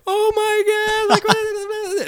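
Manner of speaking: very high-pitched imitation of panicking kids; mock freaking out. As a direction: squeal it like a kid in hammed-up panic. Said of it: "freaking out".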